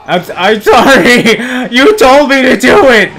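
high, loud, drawn-out protest; mock apology. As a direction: wail a slow, half-laughing apology. Mock apology to game character.

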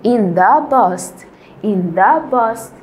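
"in the past" said very slowly, each word apart; slow modelling. Shown as a demonstration. Teacher to students learning English, very slow dictation. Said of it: "اوكي، في الماضي".

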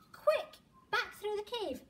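high, loud, rushing; urgent flight. Acted. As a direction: hurry them away from the bear. Fleeing the bear.

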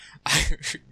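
nervous laugh after fright; relieved shaky laugh; jump scare laugh. Real, not acted.

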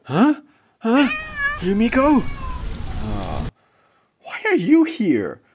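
high, loud bewildered questions; stunned surprise. Acted. Man stunned to find Yumiko there, high bewildered. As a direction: reel in disbelief.

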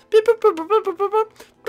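high-pitched electronic beeps; toy-like phone dialling noises; imitated dial beeps. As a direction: beep and boop like keypad tones.

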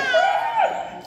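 very high-pitched screech; long squawking cry, imitating a bird; imitated bird screech. Storyteller imitating Sam still held in his arms, high screech. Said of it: "Sam was like".

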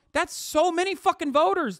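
high, loud, incredulous excitement; amazed outburst. Real, not acted. Streamer marvels at forty-three million voters, amazed. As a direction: burst out at the sheer number.

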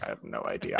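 breathy whispered reply. Retold or imitated. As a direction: drop to an unvoiced whisper. Retold reply to rival reporter.

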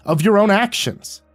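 loud, high angry tirade. A narrator's reading. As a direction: shout him down and keep hammering. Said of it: "I raised my voice and cut him off".

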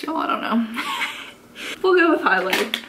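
tipsy giggling through the words. Real, not acted. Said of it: "a drunk chit chat".

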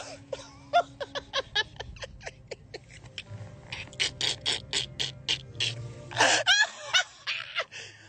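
high, wheezing, breathless laugh that won't stop; hysterical laughter. Real, not acted. Drunk streamer losing it at his friend's remark, wheezing laughter.

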